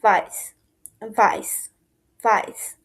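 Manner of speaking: ভাইস with a v sound, not w; German weiß. Shown as a demonstration. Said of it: "white কালারকে বলা হচ্ছে ভাইস".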